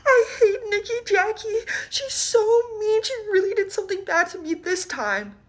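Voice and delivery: high, tearful voice of a crying friend; high teary schoolgirl's complaint. Retold or imitated. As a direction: sob it out like an upset kid.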